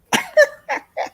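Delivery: high squealing laugh. Real, not acted. Laughing at a viewer's huge box, high squeal.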